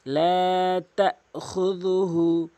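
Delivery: slow chanted লা তাখুযুহু; measured recitation; slow recitation demo. Shown as a demonstration. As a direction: recite it slowly, each vowel held. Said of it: "এক আলিফ টানবেন".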